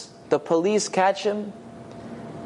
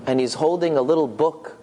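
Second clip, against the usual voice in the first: ordinary talk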